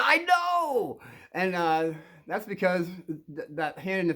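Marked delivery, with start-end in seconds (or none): 0.0-1.0 high, drawn-out exclamation; exclaiming teacher. Acted. Teacher answers the kid who has a situation, exclaiming. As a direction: pitch it way up and stretch it out.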